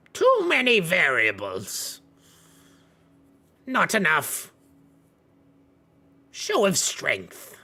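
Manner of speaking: loud, high-pitched creature pronouncements; Thrax. Acted. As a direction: belt out each short phrase with long pauses. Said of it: "Thrax kind of, uh, speaks in and goes".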